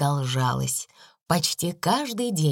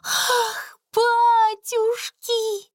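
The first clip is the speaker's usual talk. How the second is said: breathless, drawn-out gasp of amazement; panting shaky eyewitness; 3 dB louder, much slower than usual. Acted. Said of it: "задыхаясь, проговорила она".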